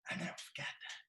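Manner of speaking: breathless hushed confession; whisper. Real, not acted. Speaker recalling his teacher's words, whispered. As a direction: drop it to a whisper to yourself.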